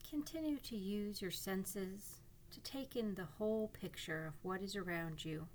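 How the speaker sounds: calm guiding voice with long pauses; guided meditation. Acted. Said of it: "This is a guided imagery meditation".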